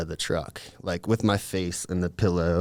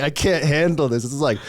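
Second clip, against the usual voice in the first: bugging out; 6 semitones higher, 3 dB louder than usual. Retold or imitated. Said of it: "I'm, like, bugging out".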